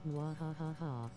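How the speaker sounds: slow evil cackle; gloating villain laugh; mad scientist. Pretend. Gloating over a tedious team.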